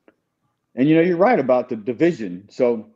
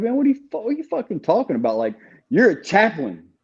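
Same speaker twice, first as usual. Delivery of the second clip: much higher, fast indignant comeback; outraged retort; 4 semitones higher than usual. Retold or imitated. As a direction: fire it back in disbelief and anger. Retold angry reply to chaplain.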